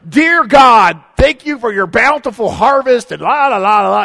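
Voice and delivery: loud, grand mock-formal prayer voice; booming churchy grace parody; pompous prayer. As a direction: ham up an overblown mealtime grace. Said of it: "so they're like".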